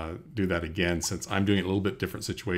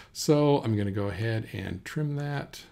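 ordinary delivery

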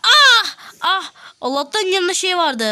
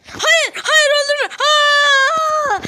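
panicked pleading; 10 semitones higher, 5 dB louder, much slower than usual. Real, not acted. Begging not to be killed.

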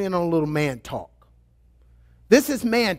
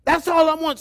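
hammed-up wailing complaint; pleading husband; 9 semitones higher, 4 dB louder than usual. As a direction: beg like a husband who misses his wife. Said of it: "And they're like".

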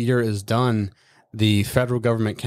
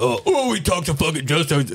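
high, whiny mimic of the sergeant; mocking whine; 7 semitones higher than usual. Mocking the sergeant who scorned him, whiny high mimic. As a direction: mimic him like a sulking kid, pitched up.

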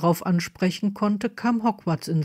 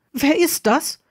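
high, alarmed cry; frightened question, pitched sharply up; panicked call; 8 semitones higher than usual. A narrator's reading. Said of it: "rief eine panische Stimme".

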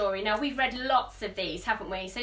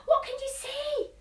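1 surprised; 11 semitones higher than usual. Acted. Spotting something in sky.

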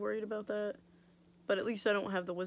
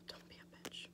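sigh, then very soft whisper; hushed pretend telling-off; whispered mock scolding; 19 dB quieter than usual. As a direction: playfully scold the tooth under your breath. Mock scolding her tooth in whisper.

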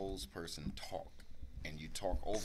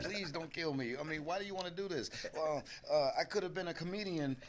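lightly voiced high cartoon begging; Bugs Bunny pleading; 10 semitones higher than usual. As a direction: beg for your life, cartoon style. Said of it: "pleading for his life".

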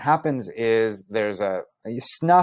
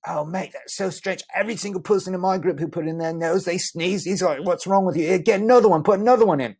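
high, pushy mate voice; insistent friend; 8 semitones higher than usual. Retold or imitated. Friend Brian insisting he try another seed, high pushy mate voice. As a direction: push him to try again.

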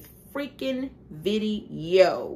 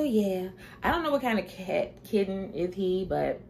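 ordinary delivery

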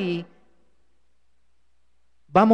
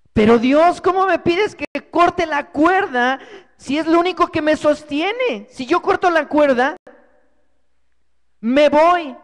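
louder and higher, in alarmed disbelief; frantic protest; 7 semitones higher, 6 dB louder than usual. Acted. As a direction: argue back in panic. Climber argues with God. (protesta alarmada)

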